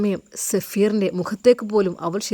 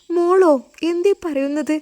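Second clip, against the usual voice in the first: louder, much higher shocked cry; 9 semitones higher, 3 dB louder than usual. Acted. Lawyer hearing the girl is their daughter, shocked cry. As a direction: jolt at the news.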